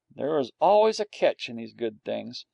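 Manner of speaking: plain reading voice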